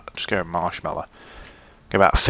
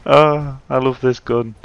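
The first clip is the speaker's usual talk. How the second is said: gleeful; 4 semitones higher, 6 dB louder than usual. Real, not acted. Gleeful after big gun kill.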